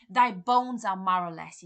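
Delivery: slow, loud accusing cry; slow furious shout; shouting at ghost. Acted. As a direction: fling it at the ghost. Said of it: "he's probably shouting".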